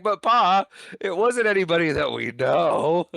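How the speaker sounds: high, loud whiny protest; naive son Joe. Retold or imitated. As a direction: plead innocently like a simple kid. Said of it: "then he's like".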